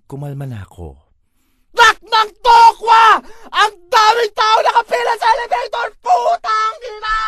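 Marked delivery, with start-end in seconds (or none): 1.7-3.2 very high, loud, slow exasperated yell; shouting at the long elevator line; frustrated shout. Acted. 3.5-5.9 very high, loud, crying-out complaint; wailing at the crowd at the elevator; anguished wail. Acted. Frustrated outburst at elevator line. 6.0-7.2 very high, loud, slow swearing yell; cursing the crowded elevator; frustrated shout. Acted. Frustrated outburst.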